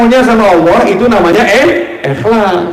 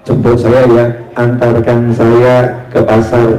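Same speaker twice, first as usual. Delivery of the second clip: kiai's request; 8 semitones lower than usual. Retold or imitated. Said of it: "kiai on the phone".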